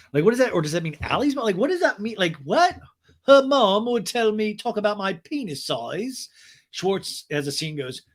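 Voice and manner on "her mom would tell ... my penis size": high, slow impersonation; hammed-up drawn-out declaration; mock man's voice. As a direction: repeat it in a put-on voice, pitched up. Repeats the James impression.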